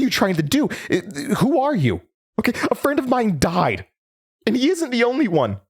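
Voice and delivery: agitated demand. Acted. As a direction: push hard for answers, rattled.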